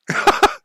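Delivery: short real laugh. Real, not acted. Streamer joking about his own grave, short laugh. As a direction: burst out laughing briefly.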